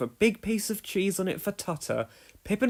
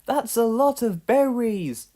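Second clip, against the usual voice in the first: high, slow wondering bird voice; 7 semitones higher, 5 dB louder than usual. A narrator's reading. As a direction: marvel slowly, little bird. Pip and Pop voiced.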